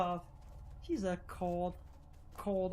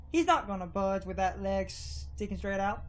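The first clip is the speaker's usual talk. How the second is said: usual speaking voice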